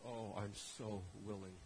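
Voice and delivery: low, soft, tender assurance; tender reply. Acted. Voices Jesus answering the leper.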